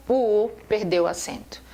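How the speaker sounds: normal talking voice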